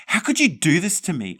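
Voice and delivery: high furious shriek; angry shriek. A narrator's reading. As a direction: shriek at her, betrayed. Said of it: "I shrieked".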